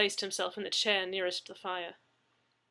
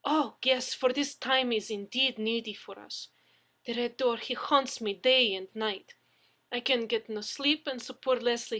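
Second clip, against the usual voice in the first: lively, louder Russian lady; Russian woman explaining her trouble, in a Russian accent; 4 semitones higher, 6 dB louder than usual. Acted. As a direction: plead your case in a Russian accent. Russian woman character held.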